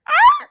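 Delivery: sudden, very high loud yelp; short piercing cry of a burned dog. Retold or imitated. Imitates dog's yelp on burning its paws.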